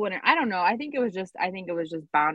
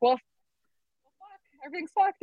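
higher, hammed-up despair; a wife hearing the machine broke, mock despair; 9 semitones higher, slower than usual. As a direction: whine it like the world has ended. Retelling her dramatic despair.

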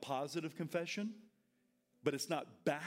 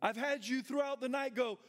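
normal talking voice

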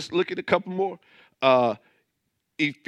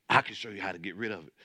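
mimicking a huckster; 8 semitones lower, 5 dB quieter, faster than usual. Mocking false teacher.

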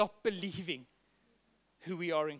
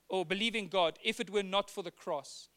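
ordinary talk, nothing marked

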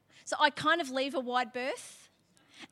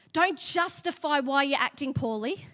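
usual speaking voice

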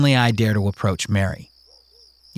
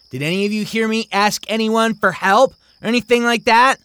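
put-on high, challenging girl; girl's voice; 13 semitones higher than usual. Mary asking the other kids, high and challenging. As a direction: put him on trial before the kids.

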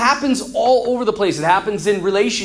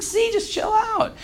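high, slow, playful soothing; told-you-so reassurance; 12 semitones higher, 4 dB quieter than usual. Acted. Alexander to people who liked his food, playfully vindicated.

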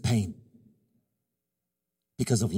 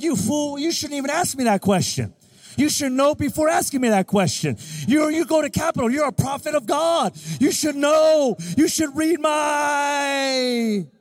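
high, loud, building scolding rant; mock scolding wife; 8 semitones higher, 5 dB louder than usual. Wife scolding her husband for asking where to eat, in a loud, mock-angry rant. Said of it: "all the women are like".

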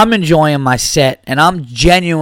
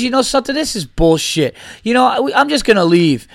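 huffy heckler; 6 semitones higher than usual. Retold or imitated. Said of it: "he goes".

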